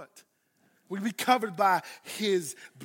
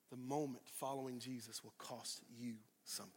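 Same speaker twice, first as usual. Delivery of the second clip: whisper; 8 semitones lower, 17 dB quieter than usual. Real, not acted. Whispered repeat of point.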